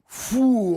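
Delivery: high, loud "phoo" whoosh; imitated swing whoosh. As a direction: mimic something swinging hard through air. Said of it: "he just goes".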